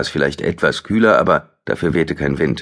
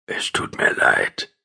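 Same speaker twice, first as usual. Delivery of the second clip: slow breathy whisper of a dying man; 8 semitones lower, slower than usual. A narrator's reading. The dying man whispers an apology close to his cheek. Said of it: "flüsterte der Sterbende".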